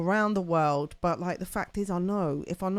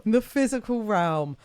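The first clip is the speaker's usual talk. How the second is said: higher, louder playful repeat of the phrase; mock-grand echo; 4 semitones higher, 5 dB louder than usual. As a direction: put PHYSICAL REALM up in lights, mock-grandly.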